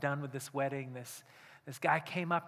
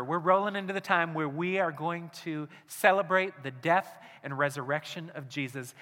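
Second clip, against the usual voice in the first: ordinary talk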